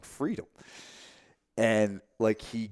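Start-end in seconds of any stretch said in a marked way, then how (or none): 0.0-0.4 a lighter echo of the crowd's shout; crowd yell. Retold or imitated. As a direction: call it out with the room. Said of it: "we were all getting up and yelling".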